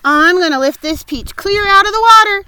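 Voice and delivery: loud, high, firm declaration; firm announcement. A narrator's reading. James announcing his plan to the insects, firmly and loudly. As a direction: announce it boldly. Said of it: "James announced firmly".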